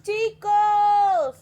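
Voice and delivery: acted far-off hail; shouting from shore. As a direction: holler to the fishermen across the water. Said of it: "un hombre que les gritó".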